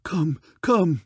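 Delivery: agitated cry. Acted. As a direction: press him to get on with it. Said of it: "cried Lord of Armon".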